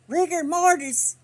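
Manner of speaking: high, loud, slow disbelieving question; doctor echoing "Rigor mortis" in shock; doctor surprised. Retold or imitated. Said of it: "He goes".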